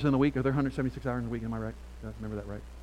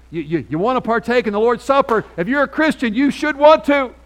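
rising to a full preaching shout, much higher and louder; fervent preaching; 9 semitones higher, 11 dB louder than usual. Acted. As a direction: preach it out at full force, slowing at the end. Preacher builds to full force.

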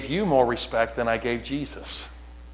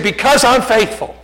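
high, forceful declaration; loud imitated answer; 11 semitones higher, 8 dB louder than usual. Young man telling the preacher why, imitated high and loud. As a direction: declare it loudly, pitched high.

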